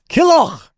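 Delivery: Killoch, punched high and loud with a throaty final ch; Scottish pronunciation. Shown as a demonstration. Host shows off guest's surname, hammed-up Scottish Killoch. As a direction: say Killoch the Scottish way, ending on a rasped ch. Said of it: "the proper pronunciation of your last name".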